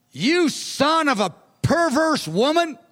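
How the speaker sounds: half-acted furious king spitting an insult; angry insult. King Saul insulting his son in front of the court, angry. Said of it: "Saul was so angry".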